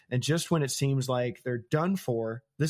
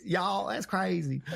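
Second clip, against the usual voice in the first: slow, high Southern drawl on y'all; 6 semitones higher, slower than usual. Shown as a demonstration. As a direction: lean into a Southern twang. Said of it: "I don't have a Southern accent".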